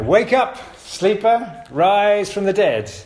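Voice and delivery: high, loud and slow, like rousing sleepers; rousing wake-up call. Shown as a demonstration. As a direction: call it out to wake the whole church. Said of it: "maybe we should start every Sunday morning like this".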